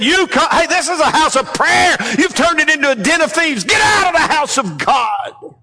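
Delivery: Jesus shouting at temple merchants, furious; angry rebuke. Acted. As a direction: drive the thieves out of the temple. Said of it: "Jesus cleansed the temple and said".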